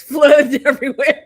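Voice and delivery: loud burst of laughter through the words; laughing. Real, not acted. Co-host reporting California rain, breaking into loud laughter. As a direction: laugh it out, half embarrassed.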